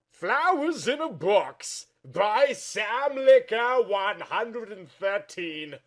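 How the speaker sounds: high, slow, loud ominous reading; eerie title read. Acted. John reads the manuscript's title aloud, high, slow and ominous. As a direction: announce the title like a creepy omen.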